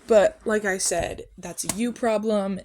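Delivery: usual speaking voice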